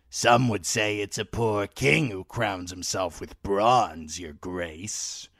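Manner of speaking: sly, mocking old man's voice; crude old lord. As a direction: play a toothless, spiteful old lord. Old Walder Frey voiced.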